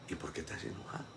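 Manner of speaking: breathy, soft ¿Y por qué te has enojado?; whispered question. Acted. Preacher leaves the congregation with a whispered question. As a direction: let the question fade to a whisper.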